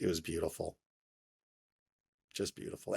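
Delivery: soft, breathy near-whisper, overcome; near whisper, moved. Real, not acted. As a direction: let the words almost vanish, moved. Moved recalling his boss's kindness.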